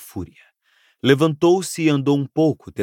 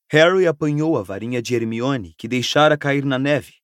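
usual speaking voice

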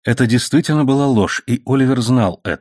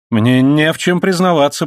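higher-pitched indignant protest; raised voice, refusing to confess; 7 semitones higher, 3 dB louder than usual. A narrator's reading. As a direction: protest your innocence. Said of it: "протестовал Лайонель".

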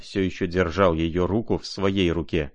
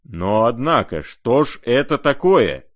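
put-on sudden loud, higher old man's outburst; menacing exclamation; 5 semitones higher, 7 dB louder than usual. A narrator's reading. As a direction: bark it out, threatening. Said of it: "грозно и внезапно воскликнул". (грозный возглас старика)

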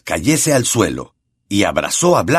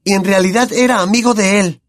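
Harry shouting; 11 semitones higher than usual. A narrator's reading. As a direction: yell it in betrayed anger. Harry accusing Lupin angrily.

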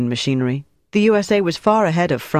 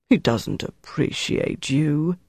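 slow, softer, hammed-up sorrow; exaggerated melancholy; 4 dB quieter, slower than usual. Mark comforting the waiter, mock-melancholy and soft. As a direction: lay on the pity, slowly. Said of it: "with exaggerated melancholy".

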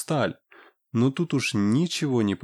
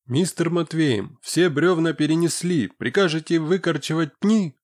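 raised, eager worker reporting; lightly voiced villager, a bit higher; Boiko's voice; 6 semitones higher, 3 dB louder than usual. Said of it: "Бойко отчитался".

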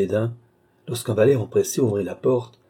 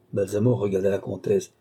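ordinary delivery, nothing marked

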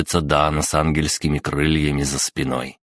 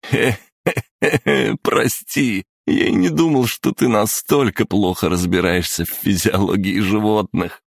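laughing; 5 semitones higher than usual. A narrator's reading. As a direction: laugh through the words. Boy laughing, apologizing.

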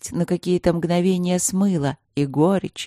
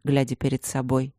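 usual speaking voice

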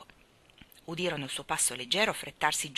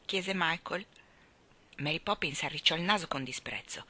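plain reading voice

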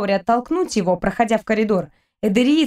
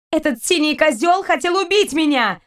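higher, furious accusation; shouted; 6 semitones higher than usual. Acted. Kristina yells at the pirates about her attacker. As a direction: yell it at the blue-skinned man. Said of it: "выкрикнула Кристина".

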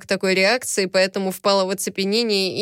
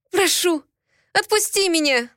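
pleading; 10 semitones higher than usual. A narrator's reading. Pleading captive girl.

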